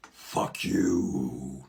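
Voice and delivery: low menacing growl; a scary deep fuck you, overdone; too scary. Pretend. Trying the movie line, too scary. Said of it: "No, that was too, too scary".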